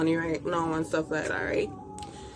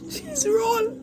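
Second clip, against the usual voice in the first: excited; much slower than usual. Real, not acted. Gleeful repeat of food name.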